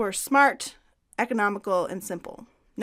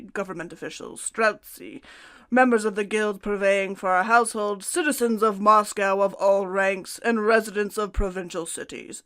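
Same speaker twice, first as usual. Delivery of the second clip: put-on loud, declaimed decree in a Russian accent; 5 dB louder than usual. Co-host reads the emperor's decree on Western dress, Russian accent. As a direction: proclaim it like an official edict, Russian-accented. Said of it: "That's my Russian accent".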